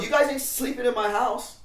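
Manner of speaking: high, loud firm no; stern refusal. Retold or imitated. Lydia setting rules for the runaway kids. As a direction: lay down the rule. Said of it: "And she's like".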